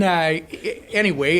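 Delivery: usual speaking voice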